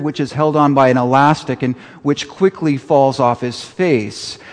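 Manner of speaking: usual speaking voice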